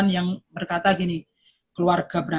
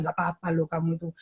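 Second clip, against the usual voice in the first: soft, low, quick comforting words; gentle reassurance; 6 semitones lower, 10 dB quieter, faster than usual. Retold or imitated. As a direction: soothe a nervous child softly. Parent reassuring child. (menenangkan anak dengan lembut dan pelan)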